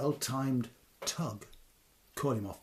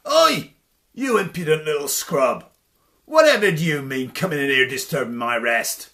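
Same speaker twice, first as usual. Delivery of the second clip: loud furious giant roar; enraged booming shout, rushed at the end; giant's shout; 13 dB louder than usual. Acted. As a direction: bellow it down at the tiny intruder. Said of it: "shouted old Denbras".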